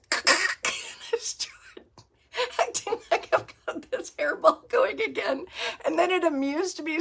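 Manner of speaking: laughing through tears, voice cracking high; crying laughter. Real, not acted. Retelling the spider story and losing it, real crying laughter. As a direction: break down laughing till it hurts. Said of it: "crying kind of laughing".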